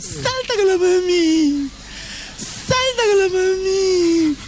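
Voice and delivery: put-on high, slow sing-song baby talk to the dogs; wife's baby talk. Mocking the wife cooing to her dogs in high baby talk. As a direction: coo at the puppies like a doting owner.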